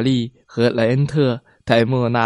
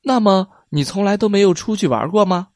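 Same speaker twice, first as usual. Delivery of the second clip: put-on child voice; 7 semitones higher, faster than usual. A narrator's reading. Child asks Mona.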